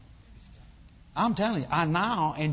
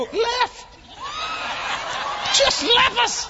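very high, loud comic outrage; indignant shout; 6 dB louder, much slower than usual. Retold or imitated. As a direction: shout it in scandalised disbelief. Indignant that father left the food.

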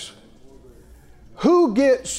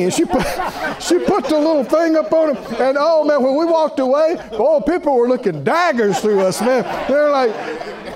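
normal talking voice

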